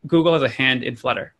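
ordinary talk, nothing marked

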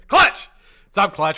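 high, loud shouted greeting; excited call-out. Real, not acted. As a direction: holler the name as a big welcome. Calling out a viewer's arrival.

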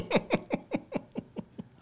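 long, high-pitched laugh; drawn-out staccato high laughter; amazed laugh. Real, not acted. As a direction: laugh in high, quick pulses. Amazed reaction to his own story.

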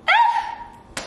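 very high happy squeal; short laughing shriek of delight; excited squeal. Real, not acted. Vlogger thrilled by gift and coming couch, excited squeal.

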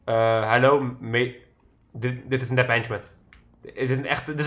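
heel zacht, ingehouden stem. Real, not acted. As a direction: praat zachtjes tijdens het sluipen. Sneaking past enemies. (gedempt en zacht)

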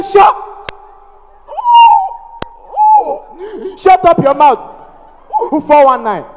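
high, loud outburst with a long choking block; explode, then stick on the word; angry stammer. Acted. Passenger heckling the medicine seller, angry mock stammer. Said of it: "got angry, stood up".

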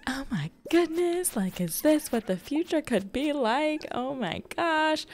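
high-pitched retold amazement; amazed reaction. Creator retelling her reaction to a big offer, amazed. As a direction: marvel in a high voice, drawing out the end.

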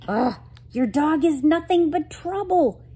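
high, exasperated complaint; loud grumbling. Acted. Girl fed up with friend's dog, grumbling. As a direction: grumble with a groaned start. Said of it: "Katie grumbled".

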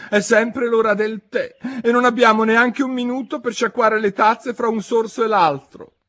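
high, loud, plaintive Hatter; comic high-pitched lament; lamenting Mad Hatter. A narrator's reading. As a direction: wail the complaint in a high character voice.

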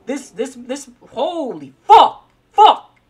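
loud, high shrieked curse; raging gamer screaming at boss; furious scream. Real, not acted. Taking a hit from boss.

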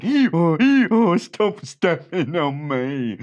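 high loud hee-haw; braying donkey. A narrator's reading. Donkey stepped on, braying loudly. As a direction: bray then complain. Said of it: "And the donkey said".

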